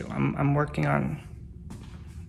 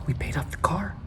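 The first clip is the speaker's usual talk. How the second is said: whisper. Real, not acted. Talking to dog Paco.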